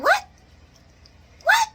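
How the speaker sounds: high, loud squawked "what"; exaggerated high-pitched disbelief; mock shock. [name] hearing about skydiving, mock shocked squawk.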